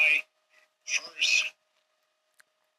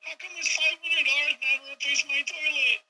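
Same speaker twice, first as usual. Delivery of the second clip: pitched-up whiny customer voice; 10 semitones higher than usual. Retold or imitated. Homeowner complaining to the plumber Phil, high mock whine. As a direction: squeak out the complaint like a put-upon homeowner. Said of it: "the customer's like".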